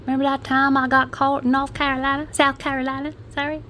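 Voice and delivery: high-pitched, badly faked American accent; terrible American accent. Suspect telling the grandkids a story, terrible American accent. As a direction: put on a hammy American accent. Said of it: "That was a terrible accent".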